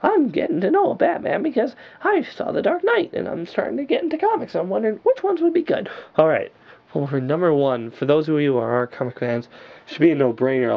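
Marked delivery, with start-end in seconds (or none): 0.0-5.9 high-pitched voice of an eager new fan reading a comment; squeaky fan voice; mock viewer voice. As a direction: play the newbie fan asking for tips, pitched way up.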